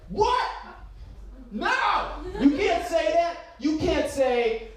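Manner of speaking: high-pitched mock outrage; outraged fact-checker. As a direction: object in high, indignant disbelief. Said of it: "not cited and be like".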